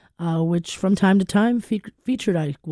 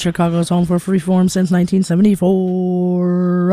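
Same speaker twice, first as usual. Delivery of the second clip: loud, drawn-out announcer delivery; radio station ID; 6 dB louder than usual. Acted. DJ announcing the Chicago freeform station to listeners, loud announcer voice. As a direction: belt out the call sign with long held vowels.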